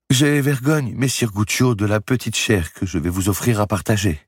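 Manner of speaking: high, thin, apologetic voice; ashamed old widow. A narrator's reading. Widow apologising to Guccio for the poor meal, ashamed. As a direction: offer the meagre meal with shame.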